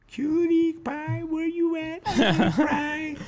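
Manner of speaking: high, slow sing-song call with a laugh; calling cutie pie. Real, not acted. Calling out to his daughter, high and playful. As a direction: call out to her playfully, stretched out.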